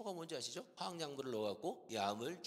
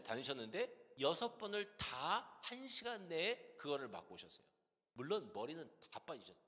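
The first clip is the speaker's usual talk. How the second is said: ordinary delivery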